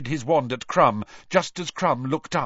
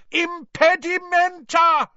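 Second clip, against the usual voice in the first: high, loud yelled jinx; yelled spell; 7 dB louder, slower than usual. Acted. Harry yelling at the charging Scroot, high loud cry. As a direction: scream the jinx in panic.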